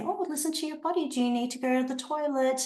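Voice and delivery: high, quick, light reminder; upbeat gentle toilet reminder. Shown as a demonstration. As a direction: nudge the child kindly. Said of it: "to say".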